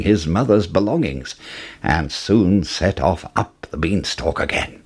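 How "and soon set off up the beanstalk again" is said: ordinary delivery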